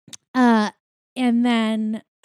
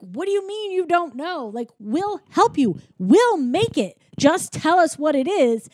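higher, insistent retold pleading; mock freaking out; 6 semitones higher than usual. Kitchen staff pleading with Linguini for the recipe, mock urgency. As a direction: press him urgently, lightly acted. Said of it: "Everyone else is freaking out".

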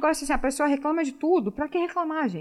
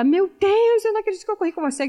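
high, fast, excited gush of disbelief; star-struck gush; 7 semitones higher, 4 dB louder than usual. Retold or imitated. Runner amazed to have run beside a pro, fast excited gush. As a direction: gush like a fan who just met her idol.

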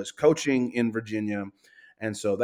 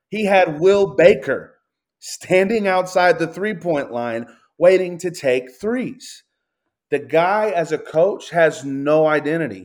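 louder and higher, venting about the coach; heated loud rant; 5 semitones higher, 6 dB louder than usual. Real, not acted. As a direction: raise the voice, fed up with the roster. Ranting, listing shooters louder.

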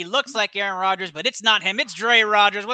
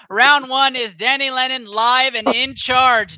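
higher, slow, boxing-style call; hype announcement; 5 semitones higher, 5 dB louder, slower than usual. Real, not acted. Host handing the segment to the guest, hyped call.

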